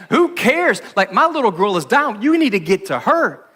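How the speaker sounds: high, loud, exasperated plea; frantic father. Acted. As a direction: push past it, your girl is dying.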